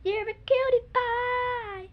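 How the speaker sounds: cutie voice. Shown as a demonstration. Kid showing how to coo at a cuddly toy. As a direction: coo it at a cute animal. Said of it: "and go like".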